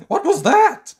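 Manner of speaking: high, loud panicked shriek; terrified shriek. Acted. Mister Jelly startled by a snore, shrieking. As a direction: scream it in sudden fright. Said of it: "shrieked Mister Jelly".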